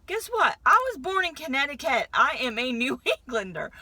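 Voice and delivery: high, loud, laughing announcement; mock proclamation. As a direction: proclaim it like a big comic reveal. Jokey proclamation of northern birth.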